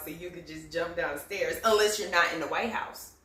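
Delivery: ordinary talk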